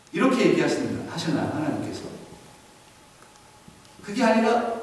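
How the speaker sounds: ordinary delivery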